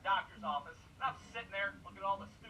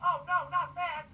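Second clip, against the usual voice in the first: mock horror; 5 semitones higher, 8 dB louder, slower than usual. Mock horror at cancer news.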